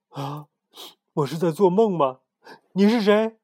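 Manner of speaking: frightened, going high and loud; frightened question. Acted. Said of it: "惶恐地问".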